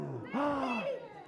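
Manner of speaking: sharp high gasp, pretending to be frightened; mock scared gasp. Librarian pretends the children's boo frightened her, gasping. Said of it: "I was kinda scared at that boo".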